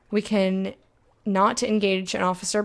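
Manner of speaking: ordinary delivery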